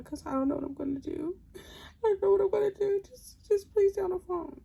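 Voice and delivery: high, sobbing plea, rising to a wail; hammed-up tearful begging on the phone; imitated crying. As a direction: mimic him crying and begging. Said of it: "He's crying".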